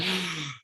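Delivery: sharp shocked intake of breath; sharp gasp. Retold or imitated. Reading a review, a sharp gasp.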